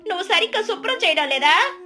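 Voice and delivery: high, fussy neighbour voice; fault-finding neighbour. Acted. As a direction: exclaim at the smell, then blame. Neighbour complains of smell.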